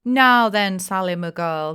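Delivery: mock stern. A narrator's reading. Father scolding daughter Sally, mock-stern call. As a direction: bark it to stop her fooling, pretend stern. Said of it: "trying to force a frown".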